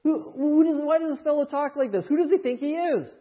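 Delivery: loud, high, outraged questioning; indignant scribes. Retold or imitated. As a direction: hurl the challenge like an offended teacher of the law. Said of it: "They say".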